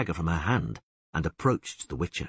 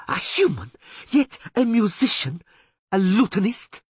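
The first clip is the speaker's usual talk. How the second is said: much higher, louder contempt; higher loud scorn; 6 dB louder than usual. A narrator's reading. Taruviel mocking the poet, higher and loud. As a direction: spit out each word. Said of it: "she growled".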